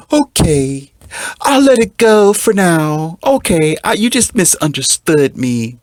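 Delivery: high, slow, whiny pushover voice; mocking a weak man. Mocking the blue pill man forgiving his girlfriend, high whine. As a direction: ham up the spineless boyfriend giving in. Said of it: "will stand there and say".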